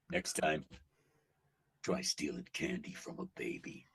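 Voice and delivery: very low, soft baritone delivery; Cable voice. Acted. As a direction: deliver the line like an unbothered anti-hero. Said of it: "he got the greatest lines".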